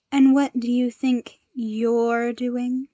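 loud, slow challenge called across the room; calling out. A narrator's reading. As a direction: call out to the villain, drawn out. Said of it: "she called".

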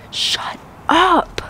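breathless whisper of disbelief; stunned whisper. Real, not acted. Shopper stunned by a price, whispering. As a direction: hush it like you can't believe it. Stunned by a rare plant find.

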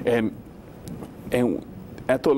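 normal talking voice

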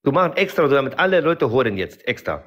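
put-on heavy foreign accent, broken grammar; broken-German accent. Character answering the interviewer in broken accented German. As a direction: play a Kurdish immigrant in broken German.

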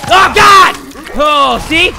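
real startled shout, high and loud; startled outburst. Real, not acted. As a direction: cry out loudly in sudden shock. Startled during play.

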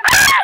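put-on very high, loud squeal of the kids; kids' shriek. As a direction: shriek back like startled children.

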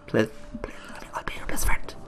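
soft whispered begging; mock-pleading whisper at the door. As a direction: whisper the plea close to Jeff. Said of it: "go there and be like".

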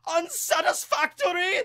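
high, slow, whiny critic voice; snooty food critic. Pretend. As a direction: sneer it like a snobby gourmet. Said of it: "That's how I imagine he talks".

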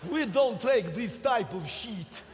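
put-on low, menacing Polish accent; mock-ominous Polish revolutionary. Polish people acted plotting revolution.

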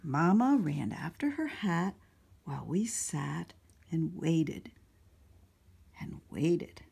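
put-on animated children's story narration; storytime read-aloud. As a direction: read it out to a room of little kids. Reads picture book to children.

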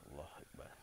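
very soft, low whisper; hushed whisper to oneself; whispered invocation. Real, not acted. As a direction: say it under your breath. Exclaims under breath in the dark.

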